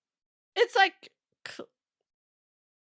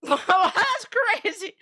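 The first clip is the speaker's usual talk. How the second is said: high, loud, drawn-out laughing reaction; 5 semitones higher, 5 dB louder, much slower than usual. Real, not acted. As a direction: burst out laughing through the words, stretching them. Laughs at what she reads.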